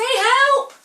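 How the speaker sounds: high, loud cry of help; calling for help. Shown as a demonstration. Showing children how to call to Jesus, loud cry. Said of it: "call to him, say".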